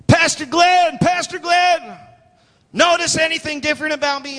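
high, excited call for the pastor's attention. Retold or imitated. Healed woman calling to her pastor, excited. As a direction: call out high, drawing out the name.

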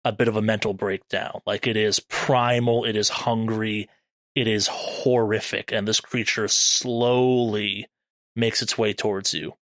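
slow, deliberate horror narration; game master narration. Acted. Game master describes the howl's effect, ominous narration. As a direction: let each dread detail land.

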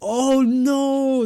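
sudden high, loud oh no; surprised oh no. Real, not acted. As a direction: blurt it out, caught off guard. Mock dismay at hockey excuse.

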